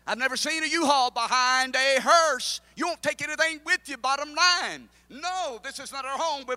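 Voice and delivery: loud, high fervent preaching; preaching at full force. Acted. Preacher to congregation, loud fervent preaching.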